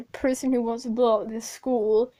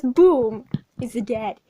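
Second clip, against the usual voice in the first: ordinary talk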